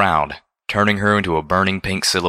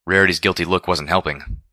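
ordinary delivery

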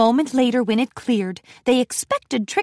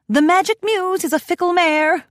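higher, far-off lady pony voice; Rarity voice; 8 semitones higher, 3 dB louder than usual. A narrator's reading. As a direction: play the elegant lady unicorn.

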